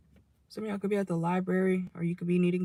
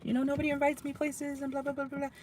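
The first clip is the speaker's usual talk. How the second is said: higher, mock-whiny child complaining; whiny kid voice; 7 semitones higher, 4 dB quieter than usual. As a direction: whine it like a left-out kid. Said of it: "they could be talking about".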